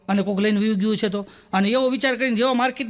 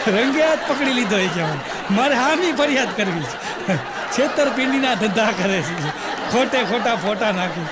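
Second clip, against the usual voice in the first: laughing. Real, not acted. Laughing at own joke.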